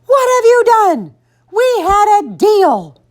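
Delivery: high, loud outraged mother; shrieking mom outrage; screaming. A narrator's reading. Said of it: "she screamed".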